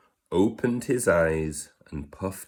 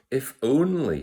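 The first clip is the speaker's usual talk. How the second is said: a touch of mouse voice; 7 semitones higher than usual. Acted. Mouse's wish, high voice.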